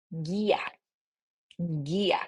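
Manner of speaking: guía with a soft G, U silent; Spanish guía. Shown as a demonstration. Teacher modelling guide in Spanish. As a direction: say guía gee-a. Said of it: "I will say".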